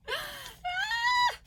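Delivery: high, loud squeal of delight; excited squeal. Real, not acted. Vlogger seeing her gift, squealing with excitement. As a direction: let out an excited shriek. Opening the awaited parcel.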